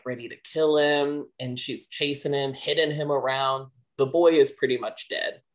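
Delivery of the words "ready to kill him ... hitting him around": ordinary delivery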